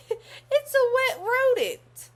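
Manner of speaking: high, loud, slow laughing line. Acted. Lewis laughing at Clark's question, amused. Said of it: "Lewis laughed".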